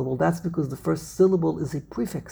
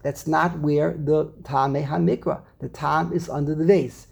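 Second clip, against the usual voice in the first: ordinary talk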